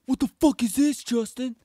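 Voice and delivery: high, loud mock dad outburst; imitating angry dad. Dad blowing up on son over the vape, angry high shout. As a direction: blow up like a furious father. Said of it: "he straight blew the fuck up on me".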